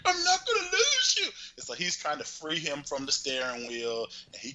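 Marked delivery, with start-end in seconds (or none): 0.0-1.3 high put-on vow; soap-opera declaration in a squeaky voice; mock devoted lover. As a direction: ham up the heroic promise, pitched high. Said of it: "So Robert was like".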